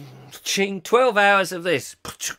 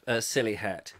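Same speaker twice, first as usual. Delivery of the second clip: normal talking voice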